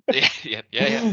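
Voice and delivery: high, loud laughing yeahs. Real, not acted. Laughing at his friend's Finland pun, high and loud. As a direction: laugh through the agreement.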